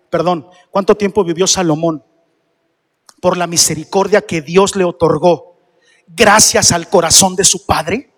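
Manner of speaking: ordinary talk, nothing marked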